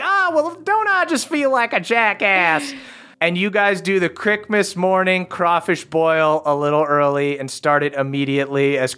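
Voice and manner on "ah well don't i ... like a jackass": high, embarrassed elf; sheepishly high; high-pitched abashed elf. Acted. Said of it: "It's like".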